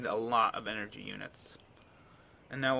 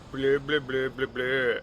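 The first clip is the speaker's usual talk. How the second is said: mock caller's blather. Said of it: "he's gonna be like".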